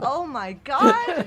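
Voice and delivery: loud, slow, laughing shock. Real, not acted. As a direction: gasp it out in disbelief. Reacts to fake deletion.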